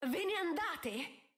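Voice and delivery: high, slow, drawn-out cry; shouted question. Acted. Sophie cries out at her stepmother, slow and high. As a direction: burst out at her after long silence. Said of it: "Sophie balzò in piedi e gridò".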